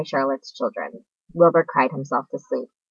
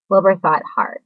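usual speaking voice

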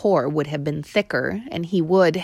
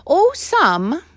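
high, brave-sounding shrug; bravado; 11 semitones higher, 4 dB louder than usual. A narrator's reading. Mouse boasting to the boy, bravado. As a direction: play it tough. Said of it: "with a display of bravado".

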